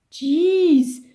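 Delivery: loud, high outburst; exploding. Acted. Alan exploding at Tom's choice, loud high outburst. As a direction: burst out in disbelief. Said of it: "exploded Alan".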